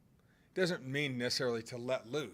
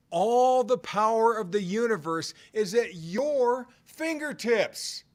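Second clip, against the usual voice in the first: louder emphatic repeat; 8 semitones higher, 7 dB louder than usual. Shown as a demonstration. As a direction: hammer it home the second time, drawing out fingertips. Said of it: "I'm gonna say it again".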